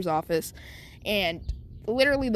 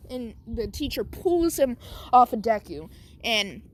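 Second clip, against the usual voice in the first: ordinary delivery, nothing marked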